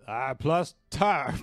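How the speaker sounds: slow "A plus tard" in a mock Austrian action-hero accent; Terminator accent. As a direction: draw out "A plus tard" like a movie cyborg. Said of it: "Oh, in the accent".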